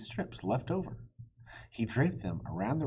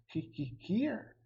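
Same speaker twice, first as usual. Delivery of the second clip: hissed; 10 semitones higher, slower than usual. Acted. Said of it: "hissed the dragon".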